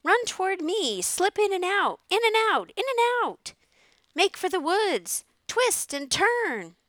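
shrieking coach of a goose urging an escape; goose crying orders. A narrator's reading. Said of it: "cried the goose".

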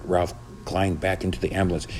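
ordinary delivery, nothing marked

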